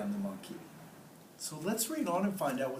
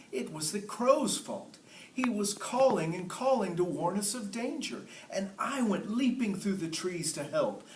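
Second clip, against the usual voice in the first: nervous monkey; 8 semitones higher, 4 dB louder than usual. Acted. Nervous monkey pleads with king.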